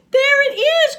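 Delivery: high, loud panicked cry; frightened cry. Acted. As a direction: yelp it out in fright. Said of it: "cried Frog".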